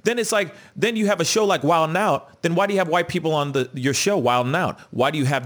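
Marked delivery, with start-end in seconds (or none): none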